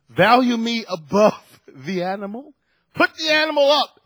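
preacher's passionate demand; emphatic plea. Real, not acted. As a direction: stretch out each word to drive it home. Pleading to be valued.

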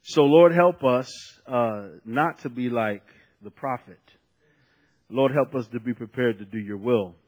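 humbly ask, dropping out of preaching pitch; subdued prayer. Real, not acted. Pastor prays to the Lord for the congregation, subdued.

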